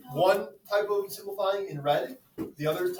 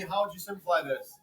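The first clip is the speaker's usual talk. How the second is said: normal talking voice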